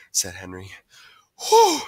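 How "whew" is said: loud, high exhaled whew; big sigh of relief; relieved whew. A narrator's reading. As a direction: blow out the tension after an escape. Relief after escaping chase.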